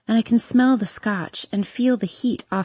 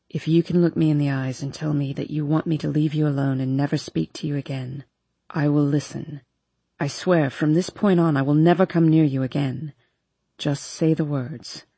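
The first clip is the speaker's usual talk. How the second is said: low brooding man's voice; 6 semitones lower than usual. A narrator's reading. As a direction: voice the brooding boyfriend, low and urgent. Hardin's voice in story.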